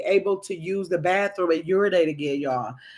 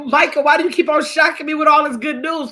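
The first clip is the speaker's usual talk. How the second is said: high, loud mock complaint; retold excitement; 7 semitones higher, 6 dB louder than usual. Vlogger to Michael about his wife's new kidney, excited. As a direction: raise pitch and volume, delightedly exasperated. Retold reaction to good news.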